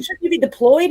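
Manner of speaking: lightly voiced grumpy father; a hint of a stern dad, questioning. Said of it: "Martin Lawrence is grumpy".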